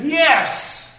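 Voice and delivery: loud, high emphatic yes. Shown as a demonstration. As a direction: shout it out in full agreement.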